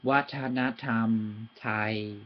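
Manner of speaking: watanatam, slow and even like a robot. Shown as a demonstration. Teacher showing robotic even delivery. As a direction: speak it like a robot, syllable by syllable. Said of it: "speak like a robot".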